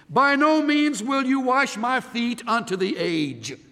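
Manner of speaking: high, loud, emphatic refusal. Retold or imitated. Peter pulling his feet back from the Lord, emphatic. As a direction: refuse each word with force. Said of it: "in rather emphatic Greek".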